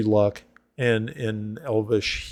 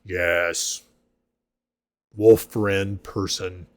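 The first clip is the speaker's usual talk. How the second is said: a gruff-sounding half-orc, gushing and greedy for more; hearty half-orc; 3 semitones lower, slower than usual. Acted. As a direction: voice the big half-orc begging for the recipe. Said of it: "And he's like".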